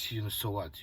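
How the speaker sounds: ordinary talk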